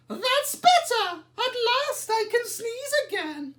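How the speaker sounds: put-on very high, loud relieved old lady. A narrator's reading. Old lady relieved her hen is gone, high and loud. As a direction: sigh it out like a happy little old lady.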